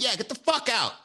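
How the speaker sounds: high-pitched imitation of her dismissal; put-on outraged send-off; mock angry. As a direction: snap it as the irate date. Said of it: "yelling tirade".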